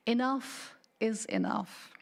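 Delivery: ordinary delivery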